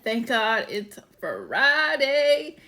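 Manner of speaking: drawn-out, loud, happy exclamation; excited quote. Retold or imitated. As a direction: stretch it out with delight. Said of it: "Yes, I got so excited".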